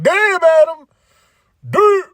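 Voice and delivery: high, loud exclamation; loud mock shock; shocked reaction. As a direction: exclaim it high, stunned by the burn. Acts own shocked reaction.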